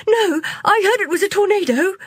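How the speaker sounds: high, loud, fast yell. Acted. Postman yelling back in the crowd, high and fast. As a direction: yell back over them. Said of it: "yelled Akash".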